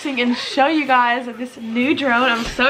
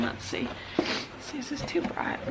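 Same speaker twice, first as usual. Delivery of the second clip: soft unvoiced whisper; 13 dB quieter than usual. Real, not acted. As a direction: whisper it to yourself. Whispered aside.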